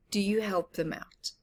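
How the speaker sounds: plain reading voice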